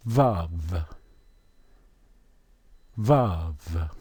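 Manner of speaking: Vav said low as vahv; Yiddish Vav. Shown as a demonstration. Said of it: "What is the name ... for them in Yiddish?".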